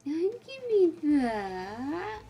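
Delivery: mock stern. Real, not acted. Owner mock scolding her begging dog, drawn out. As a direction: stretch it out like a fake telling-off.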